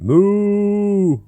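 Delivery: high, loud, long mooing call; cow moo. Acted. The cow lows at Marvin, loud and long.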